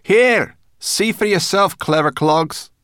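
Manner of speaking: loud, high jibe; taunting. A narrator's reading. Sorrel handing Ben the map, taunting. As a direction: throw it back as a taunt.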